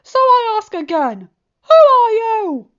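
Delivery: loud demand. A narrator's reading. Discord demanding again.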